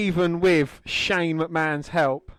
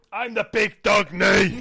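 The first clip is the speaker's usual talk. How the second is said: hammed-up, raised-pitch boast; boasting wrestler; 4 semitones higher than usual. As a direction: crow it in a put-on wrestler voice.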